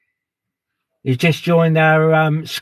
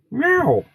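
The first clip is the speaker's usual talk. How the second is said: very high, playful mock cat meow; 12 semitones higher than usual. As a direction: squeak out a cat's meow up high. Viewer named Catwoman greeted.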